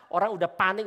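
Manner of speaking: usual speaking voice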